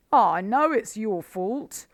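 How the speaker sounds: high, loud, slow angry retort; furious Badger. A narrator's reading. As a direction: Badger snapping back in anger. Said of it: "said Badger furiously".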